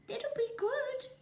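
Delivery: very high, slow chick voice; timid little bird reply; little kiwi. A narrator's reading. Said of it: "replied the little Kiwi".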